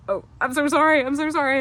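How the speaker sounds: high, loud embarrassed apology; mock mortified sorry; flustered apology. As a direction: gush out the apology. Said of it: "I was like".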